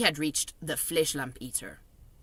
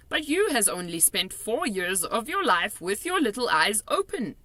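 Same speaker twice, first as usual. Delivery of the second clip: higher, louder friendly giant voice; kindly giant, raised voice; BFG giant voice; 7 semitones higher, 4 dB louder than usual. A narrator's reading. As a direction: play the big friendly giant, chatty and odd.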